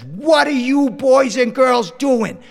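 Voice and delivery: put-on a mother hollering at the kids outside; aunt calling out.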